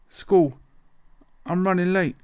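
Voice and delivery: voice going up. Retold or imitated. Scared schoolboy answers the rude boy, voice going up. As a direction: let the voice jump up in panic. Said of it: "My voice goes up an octave".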